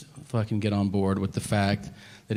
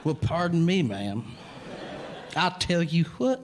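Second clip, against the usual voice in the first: cowboy persona; 6 semitones higher than usual. Retold or imitated. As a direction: play a would-be cowboy. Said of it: "they have adopted the cowboy persona".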